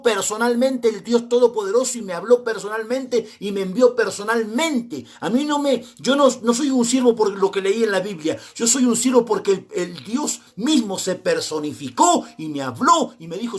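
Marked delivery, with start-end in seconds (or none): none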